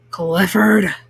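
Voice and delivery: low, startled shout of the dog's name; shocked cry. A narrator's reading. Baker sees the dog where his cookie was, low shocked cry.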